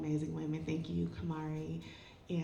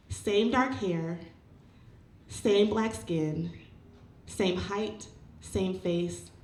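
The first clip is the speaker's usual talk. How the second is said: measured poetry recital, louder than casual chat; spoken-word poem; 6 dB louder than usual. Acted. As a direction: recite with deliberate phrasing and pauses. Performs a personal poem onstage.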